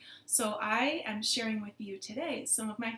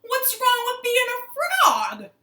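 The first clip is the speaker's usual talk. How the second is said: loud, high protesting frog voice; offended frogs; 13 semitones higher, 13 dB louder, slower than usual. Acted. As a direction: shout back, stretching it out indignantly. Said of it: "the frogs all said".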